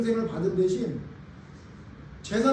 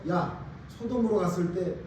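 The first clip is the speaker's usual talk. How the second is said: tender God; 4 semitones lower, 4 dB quieter than usual. Retold or imitated. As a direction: speak softly, like comforting a tired friend. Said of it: "그래서".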